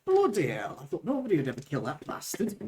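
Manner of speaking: Goliath smith sliding toward Irish; amazed smith with a wandering Irish lilt; Irish-drifting accent. Acted. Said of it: "then it went to Ireland".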